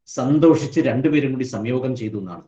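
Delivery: usual speaking voice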